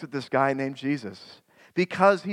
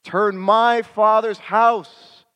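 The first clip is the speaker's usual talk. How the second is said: slow, louder, outraged yell; angry yell; 5 semitones higher, 5 dB louder, slower than usual. Acted. Jesus driving merchants from the temple, a slow furious yell. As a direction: drag out each word in fury. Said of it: "Then Jesus finally yells".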